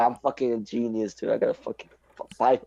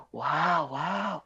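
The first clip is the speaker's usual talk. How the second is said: higher, softer cooed wows; impressed classmate; 5 semitones higher, 6 dB quieter than usual. Retold or imitated. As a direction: mimic drawn-out admiration. Retelling the classmate's amazement.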